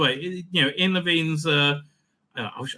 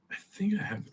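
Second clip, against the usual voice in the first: whispered aside; 2 semitones higher, 11 dB quieter, faster than usual. Real, not acted. Muttering while searching bookmarks.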